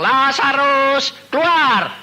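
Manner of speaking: slow, high, loud summons; booming command to come out; commanding call. Retold or imitated. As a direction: call the dead man out of the tomb, drawn out. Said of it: "Tuhan bilang".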